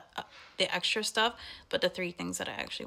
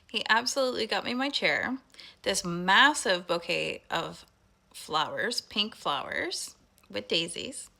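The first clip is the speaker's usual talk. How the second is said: normal talking voice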